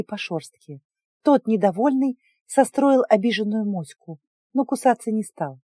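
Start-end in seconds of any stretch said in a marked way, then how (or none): none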